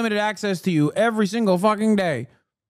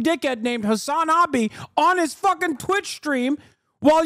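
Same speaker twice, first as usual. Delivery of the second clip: higher, nagging voice picking at a coworker; mocking busybody; 9 semitones higher than usual. Streamer imitating a nosy critic at someone's job, higher nagging. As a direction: lecture Karen like a smug office snoop. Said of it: "and go".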